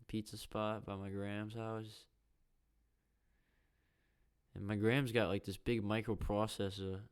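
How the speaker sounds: loud, pushy New Yorker voice; New Yorker accent. Acted. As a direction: put on a New Yorker accent while telling a neighborhood story. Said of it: "pretend to talk like a New Yorker".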